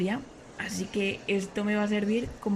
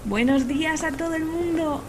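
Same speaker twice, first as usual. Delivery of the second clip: cheery vlog greeting; 7 semitones higher than usual. Acted. Greets followers in a new story.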